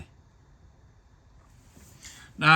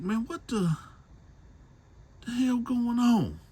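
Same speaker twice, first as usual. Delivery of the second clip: a scared man waking outside, voiced high; scared confusion; 14 semitones higher than usual. Waking outside a cabin, he asks in high alarm.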